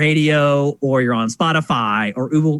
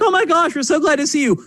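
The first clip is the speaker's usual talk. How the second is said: relieved parents; 10 semitones higher than usual. Retold or imitated. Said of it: "they're like".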